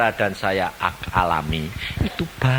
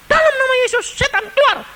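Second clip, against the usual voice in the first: commanding exorcism. Retold or imitated. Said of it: "Tengking sama dia".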